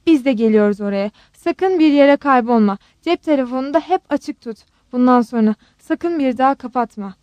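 usual speaking voice

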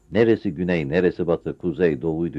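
usual speaking voice

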